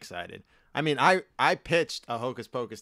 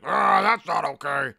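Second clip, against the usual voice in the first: indignant Donnie; 6 semitones higher, 9 dB louder than usual. Retold or imitated. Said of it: "Donnie would be like".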